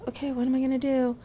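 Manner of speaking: mock lost. Young graduate imitating her worried younger self. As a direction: sound suddenly unsure. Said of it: "I was just like".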